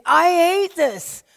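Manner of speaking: loud, slow, pained cry of I hate this; anguished outburst. Retold or imitated. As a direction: let the hurt burst out. Honest prayer amid tears.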